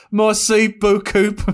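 put-on slow English-accented French, laughing; foreign accent in french. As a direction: say it with a clumsy Brummie accent. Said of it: "can't say anything in French without it sounding ridiculous".

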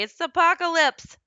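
half-joking, high and loud apocalypse cry; a playful overblown it's the apocalypse; mock doom announcement. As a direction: proclaim the end of the world as a joke. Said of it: "But I actually said it and started laughing".

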